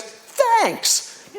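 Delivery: high-pitched, beaming thanks; delighted child. Retold or imitated. Little boy thanking the man who understood, high delight. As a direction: voice a little boy's delight through a big smile. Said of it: "big smile breaks out in his face".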